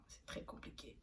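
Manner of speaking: soufflé à voix basse, sans voix; whisper. Real, not acted. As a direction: chuchote l'aparté comme un secret. Aparté chuchoté sur le belge. (chuchoté, sans voix)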